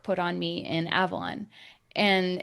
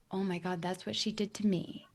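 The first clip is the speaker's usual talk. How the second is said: hushed realization; 7 dB quieter than usual. Retold or imitated. Sudden dark realization.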